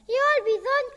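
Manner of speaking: very high goat voice. Acted. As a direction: bleat a cheerful yes. Goat answers the boy.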